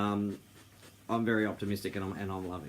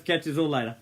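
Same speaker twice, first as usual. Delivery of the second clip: ordinary talk, nothing marked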